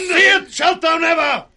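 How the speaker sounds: furious shouted threat; savage retort. Acted. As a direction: snarl it back at him. Cornwall answers the curse.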